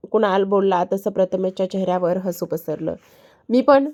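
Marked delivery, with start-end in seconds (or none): 3.5-3.9 raised, louder narrated yell; calling out. A narrator's reading. Prathamesh yelling after Kunal from behind, calling out. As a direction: pitch it up and call after him. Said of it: "Prathamesh मागूनच ओरडला".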